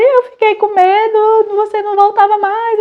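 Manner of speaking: put-on high, slow child's voice, mildly tearful; imitating tearful son. Son telling his mother he was scared she wouldn't come back, mild tearful high voice. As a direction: plead like a scared little boy, slowly. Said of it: "e ele aos prantos".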